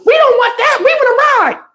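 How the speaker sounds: high mock child voice; kids' voice. Kids refusing the water.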